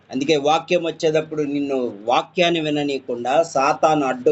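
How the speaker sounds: normal talking voice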